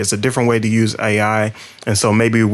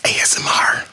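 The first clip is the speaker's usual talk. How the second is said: mock ASMR whisper. Co-host joking after throwing his partner off, whispering. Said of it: "And we're whispering".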